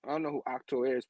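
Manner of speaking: plain reading voice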